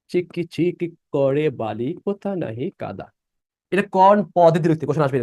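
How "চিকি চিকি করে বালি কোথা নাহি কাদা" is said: slow, measured line of poetry; verse recitation. Shown as a demonstration. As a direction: recite it slowly, syllable by syllable. Teacher recites a verse as example.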